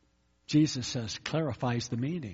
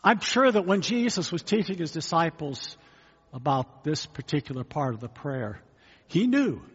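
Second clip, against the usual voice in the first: normal talking voice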